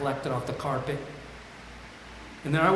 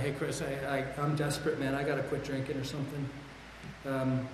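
softer, a hushed desperate plea; 8 dB quieter than usual. Retold or imitated. Confessing to his college buddy Chris, softly and desperately. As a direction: confess your desperation quietly. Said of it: "I opened up to a buddy ... I'm desperate".

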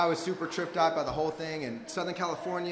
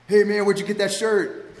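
loud, higher, drawn-out challenge; brash fella; 5 semitones higher, 9 dB louder than usual. Retold or imitated. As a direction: call it out like a big brash guy. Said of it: "big, tall, brash fella comes up".

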